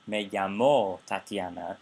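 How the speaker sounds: "llamó" drawn out on its final -mó; slow focus intonation on the end of "llamó"; stress on the verb ending. Shown as a demonstration. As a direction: stretch and stress the last syllable of "llamó". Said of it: "because she can't say".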